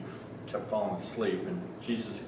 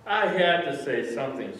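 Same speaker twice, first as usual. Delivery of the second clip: ordinary talk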